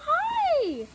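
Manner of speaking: loud high greeting to a dog; excited hi. Real, not acted. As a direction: call it out with delight.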